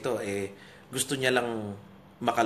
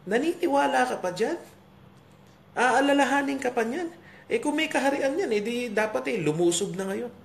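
high-pitched, incredulous jeering; mocking onlooker, pitched well up; scoffing leaders; 10 semitones higher than usual. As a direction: scoff at the believer in a raised pitch. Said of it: "yung iniisip siguro ng mga pinuno".

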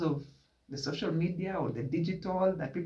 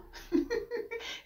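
high laugh; 4 dB quieter than usual. Real, not acted. Vlogger cracking up at the story, high laugh.

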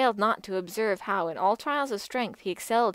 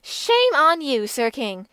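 louder, higher, scornful rebuke; in great wrath; 5 semitones higher, 7 dB louder than usual. A narrator's reading. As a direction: scold the king to his face. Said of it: "in great wrath she fled".

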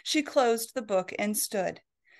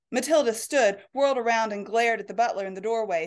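ordinary delivery

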